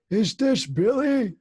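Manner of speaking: put-on high, slow old man asking; hammed-up confused grandpa. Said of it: "I bust out laughing ... one of my favorite things that you do".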